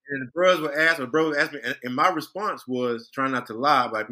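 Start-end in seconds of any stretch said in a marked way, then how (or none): none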